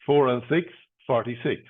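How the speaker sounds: steady sing-song number calling; bingo caller. Acted. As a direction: call each number out, then repeat it.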